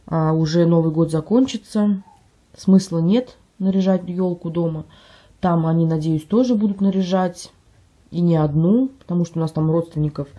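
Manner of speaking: ordinary talk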